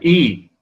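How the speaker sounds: a clear long إي. Shown as a demonstration. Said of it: "والياء هكذا". (إي ياء مدية خالصة)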